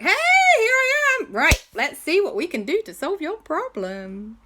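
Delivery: high, loud cheerful entrance; cheery arrival. Acted. J B arriving at Jurassic World to help, bright and loud. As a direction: burst in ready to help.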